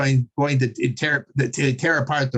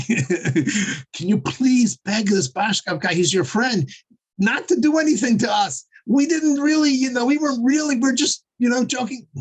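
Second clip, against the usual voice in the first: high-pitched, hammed-up begging; climbing, wheedling excuse-making; mock pleading; 9 semitones higher than usual. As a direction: imitate men backpedaling in a panic. Acts out frightened men begging for help.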